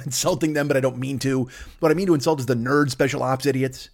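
usual speaking voice

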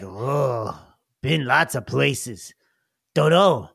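low, slow goblin voice; a hint of a dim goblin, musing. Speg answering what he ate on travels, low goblin. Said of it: "Speg is like".

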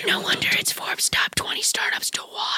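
hushed whisper; whispered ad read. Pretend. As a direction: whisper the boast to the listeners.

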